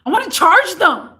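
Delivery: imitating a yelling customer, high and loud; retold yelling. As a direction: yell it back as the irate customer. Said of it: "He yells at me".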